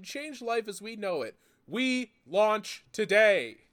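mock epic product announcement; grandiose pitch-man reading. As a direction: sell it like a hype launch. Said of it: "reading [name]'s post".

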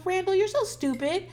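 put-on high, whiny kid scolding his tablet; mocking kid voice. Son acting out talking to his tablet, high whiny. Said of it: "I go".

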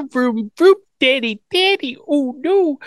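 put-on very high babyish prattle; imitated little-girl squeak; mock baby talk. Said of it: "she's baby talking".

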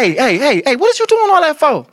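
high-pitched comic protest; mock bedroom scolding. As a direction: act out an indignant lover, voice pushed way up.

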